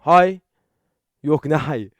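normal talking voice

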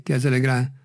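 ordinary delivery

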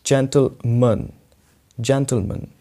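stressed first syllable of gentleman; gentleman as "JEN-tl-mun". Shown as a demonstration. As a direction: demonstrate gentleman with short "mun". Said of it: "तो ये क्या हो जाएगा".

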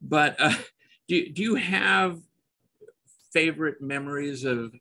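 ordinary talk

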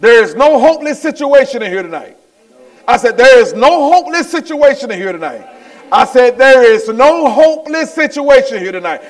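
high, loud, rapid declaration; rousing preacher's refrain; fervent preaching. Real, not acted. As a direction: proclaim it with mounting force. Preaches at full force.

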